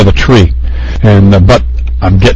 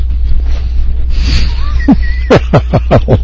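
breathy laugh; 13 semitones higher, 5 dB quieter than usual. Real, not acted. Laughing with food in mouth.

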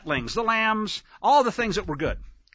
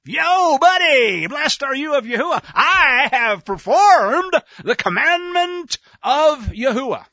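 high, loud, slowing mock proclamation; boastful greeting; 7 semitones higher, 7 dB louder than usual. Saul boasting to the prophet, loud and hearty. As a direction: hail the prophet with hammed-up bravado.

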